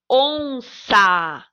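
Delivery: onça slowly, with a long first syllable; stress on ON. Shown as a demonstration. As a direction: stretch the ON in onça. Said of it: "qual é aquela que a gente pronuncia com mais força".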